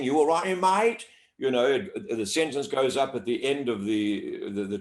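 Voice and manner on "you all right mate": Australian twang, higher and rising at the end; twangy Australian. Shown as a demonstration. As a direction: toss out a twangy Aussie greeting, tune going up. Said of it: "sort of twang".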